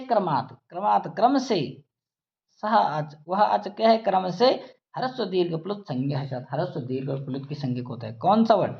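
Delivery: ordinary talk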